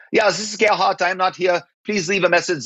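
imitating Gerhard's recorded greeting; voicemail impression. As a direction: mimic a German exec's voicemail. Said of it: "I could imitate their voice".

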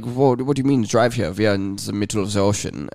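baffled reply in a mock German accent. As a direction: imitate a puzzled German tourist. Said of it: "That's a really good German accent".

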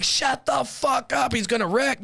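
high, drawn-out shut-up; retold frantic scolding; exasperated yell. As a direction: yell over a screaming carload. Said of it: "everyone's fucking screaming. I'm like".

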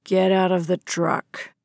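father coldly ordering daughter out of the truck; controlled anger. A narrator's reading. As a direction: keep it icy and controlled. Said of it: "his tone was bone-chillingly controlled".

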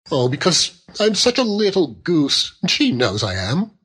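high, coaxing wife voice; childlike young wife. A narrator's reading. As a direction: make a helpless little excuse. Young wife making childish excuse.

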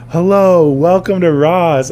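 loud, high, drawn-out welcome; big sing-out shop greeter voice; friendlier greeting. Retold or imitated. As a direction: pitch it up and sell the friendliness. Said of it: "Be friendlier".

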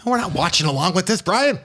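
high-pitched mock scolding; mock indignation. Host shuts down Brian's watch-along plan, mock indignation. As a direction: shut the idea down in a high, exasperated pitch.

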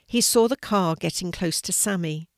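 usual speaking voice

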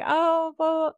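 high airy dumb-blonde impression; ditzy voice. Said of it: "you know, just ditzy".

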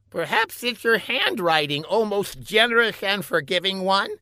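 high-pitched, halting lackey voice; hesitant scientist underling. A narrator's reading. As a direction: break bad news to the boss gingerly.

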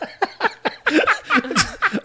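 cackling laugh; high burst of laughter. Real, not acted. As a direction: crack up hard at the joke. Big laugh at the remark.

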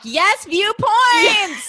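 high, loud, drawn-out cheer; thrilled actor listeners. Pretend. Host voices actor listeners hearing about viewpoints, mock-ecstatic cheer. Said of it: "actor listeners being like".

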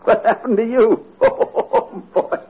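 high, breathless laughter; laughing. Acted. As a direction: burst out laughing at him. Herb bursts out laughing at eye.

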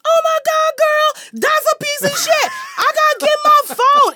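a flight attendant shrieking over a gross find; shocked flight attendant. Retold or imitated. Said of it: "and he goes".

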